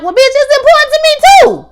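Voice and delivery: loud, high-pitched outraged yell; furious yell. Acted. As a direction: Hen blows up at Sydney. Hen snapping back at Sydney.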